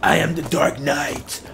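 low, slow growl as the Dark Knight; Batman voice. Pretend. Gamer doing Batman for scared teammates, low slow growl. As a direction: rasp it out like a brooding caped hero. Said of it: "Batman, hold me".

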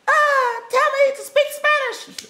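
high, slow mocking voice of the doubters; mocking heckler. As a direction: mimic the doubters who want proof, high and drawn out.

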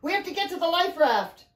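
high-pitched, urgent command; urgent cry. A narrator's reading. Father sends them to the life raft, urgent cry. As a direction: cry out to get them moving. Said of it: "Riley's father cried".

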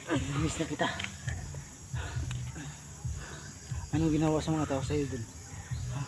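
soft, tender questions. Real, not acted. Reunited with child.

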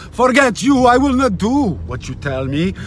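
ordinary talk, nothing marked

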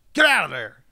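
loud, high yell out the window; retold yell. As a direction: imitate a guy yelling from a passing truck. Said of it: "the guy would yell at the".

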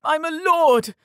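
very high, desperate cry; screamed protest. A narrator's reading. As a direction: scream it while pinned down. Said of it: "Yared screamed into the air".

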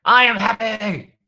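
loud, high, drawn-out yell; slow, high-pitched rallying shout; high-energy affirmation. Shown as a demonstration. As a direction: belt it out with full energy. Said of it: "put some energy into it".

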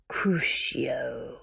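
whispered furious spell; dark lord cursing his servant; furious curse. Acted. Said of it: "his fury evident in his tone".

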